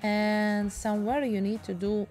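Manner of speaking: ordinary talk, nothing marked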